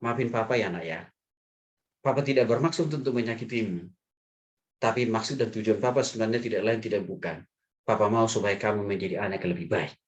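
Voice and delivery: low, gentle fatherly apology; tender apology. Retold or imitated. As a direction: soothe your child while saying sorry. Retells apologising to his child. (permintaan maaf ayah yang lembut, nada rendah)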